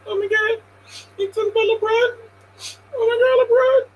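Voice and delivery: high, whiny fake-crying fan voice; mock a fan on the verge of tears over LeBron; mock emotional LeBron fan.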